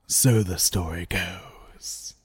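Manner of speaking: slow eerie whisper; spooky whisper. Acted. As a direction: breathe it like a ghost story. Ominous aside about the legend.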